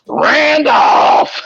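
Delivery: high, hollered name; imitated calling out. Patient yells for her ex-husband, imitated holler.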